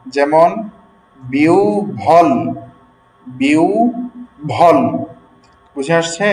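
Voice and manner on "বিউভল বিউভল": বিউভল drawn out slowly as biu-bhol; demonstrated pronunciation. Shown as a demonstration. Teacher showing students the rule, বিউভল slowly split as biu-bhol. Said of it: "ব হবে ভ। যেমন".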